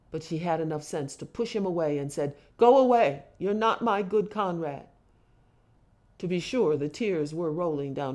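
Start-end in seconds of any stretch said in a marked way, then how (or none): 2.6-4.9 loud, high opening, then easing; sharp rejection. A narrator's reading. Mother sending crying son away, sharp rejection. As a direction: push him away with a raised voice.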